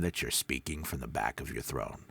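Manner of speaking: deep, back-of-the-throat tone; that low voice. Shown as a demonstration. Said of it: "you might not be that low".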